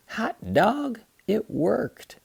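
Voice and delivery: put-on high, delighted child's cheer; high excited exclamation; triumphant kid. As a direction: whoop it like a boy whose gadget works.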